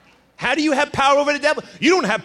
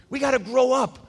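ordinary talk, nothing marked

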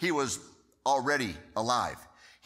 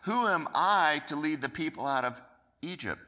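higher, louder pleading objection; protesting; 5 semitones higher, 4 dB louder than usual. Acted. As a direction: push back, unsure of yourself. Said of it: "Moses protested to God".

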